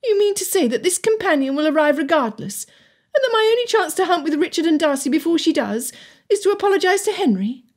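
put-on high-pitched, incredulous girl; daughter's angry disbelief, pitched well up; indignant daughter. A narrator's reading. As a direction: challenge your father in a high, indignant voice. Anger boiling up in daughter.

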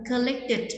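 "collected" with the ending as its own syllable, "tit"; đuôi -ed đọc /ɪd/. Shown as a demonstration. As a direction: give "collected" a full final syllable. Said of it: "chúng ta sẽ phát âm là". (đọc "collected" rõ âm tiết cuối "tít")

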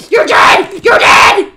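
loud, forceful shouting; angry threat. Real, not acted. Player threatening a kill stealer, loud angry shouting.

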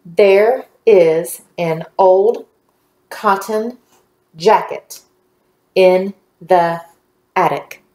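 very slow, a pause after each word; word-by-word reading. Shown as a demonstration. Teacher leading the class reading together, slow and word by word. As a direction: read it out one word at a time. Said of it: "Let's read together".

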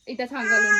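shouting over crying. Real, not acted. Child crying, mother shouts over.

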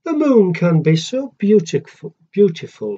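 usual speaking voice

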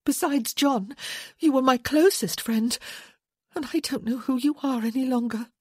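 accusing. A narrator's reading. Woman reproaching her late husband's closest friend, accusing.

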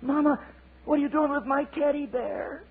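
high, whining little eaglet voice; squeaky baby bird complaining; whiny child eaglet. Acted. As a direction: plead like a small child.

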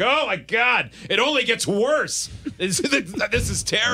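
high, slow dismayed wail; mock dismay. Player on the bus to Green Bay, in mock dismay. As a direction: play up the horror of a bleak bus ride.

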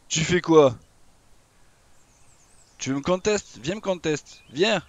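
provocation lancée plus haut et fort; défie-le en criant; défi crié. Real, not acted. Défie le dino dans le jeu. (défi crié à l'adversaire)

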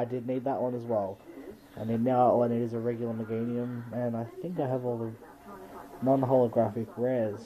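normal talking voice

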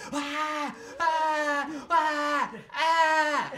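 repeated high, loud shrieking screams; blood-curdling scream. Retold or imitated. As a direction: shriek over and over, terrified. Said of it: "I hear blood-curdling scream".

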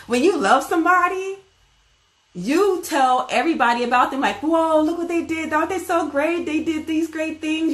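bragging on a friend with delight; gushing praise. Pretend. A speaker gushes to everyone about someone they love, high and excited.

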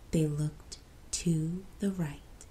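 slow whispered turn to the right; suspense whisper. A narrator's reading. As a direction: hush before the scare. Spooky story whisper.

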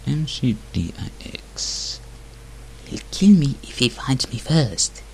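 usual speaking voice

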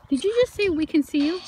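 loud, high-pitched disbelieving question; astonished question. Real, not acted. Ghost hunter checking a spirit's answer, astonished. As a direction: call back amazed at what you heard.

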